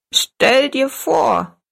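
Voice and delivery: put-on high, slow delighted old-woman cry; a tiny granny bursting with delight; cheerful exclaim. A narrator's reading. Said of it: "rief die kleine Frau fröhlich".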